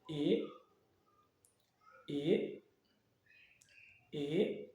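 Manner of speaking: "E" as "ee", rising at the end; soft upward sweep on each "E"; second tone. Shown as a demonstration. Teacher drills the vowel for learners, "E" rising softly. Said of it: "Le deuxième".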